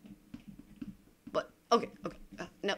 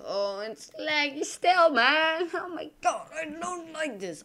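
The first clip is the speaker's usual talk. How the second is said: much higher, distressed whine; whiny frustration; 10 semitones higher, 5 dB louder than usual. Real, not acted. Gamer complaining to viewers about lag, high whine. Lag before the game starts.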